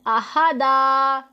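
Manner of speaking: अहादा with the last aa drawn out slowly; pausal long alif. Shown as a demonstration. Teacher shows two zabar becoming one, अहादा with long final aa. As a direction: hold the final vowel of अहादा, no n at the end. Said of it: "एक ज़बर में बदल देना है".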